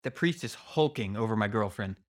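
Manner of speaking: ordinary delivery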